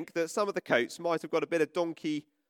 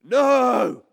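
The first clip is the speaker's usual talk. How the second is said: loud higher protest; booing crowd; 4 semitones higher, 13 dB louder than usual. Retold or imitated. Vicar voicing the people rejecting Jesus, loud. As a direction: shout it down. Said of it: "and others".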